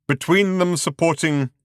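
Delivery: rolling on. A narrator's reading. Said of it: "the voice rolled on".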